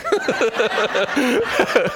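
real high laugh. Real, not acted. Laughing at his own devil voice. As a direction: burst out laughing.

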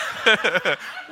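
short real laugh mid-sentence; chuckle. Real, not acted. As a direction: crack up briefly.

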